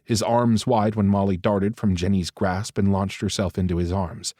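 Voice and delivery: ordinary delivery, nothing marked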